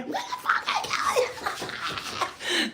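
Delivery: high, slow, breathless laughing yell; laughing shriek. Retold or imitated. Retelling chasing daughter, laughing scream. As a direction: squeal the threat through laughter. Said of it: "I was like".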